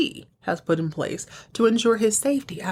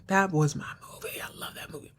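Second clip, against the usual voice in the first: whispered aside; 7 dB quieter, faster than usual. Real, not acted. Recapper admits loving the movie, whispering. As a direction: trail down into a near-silent whisper.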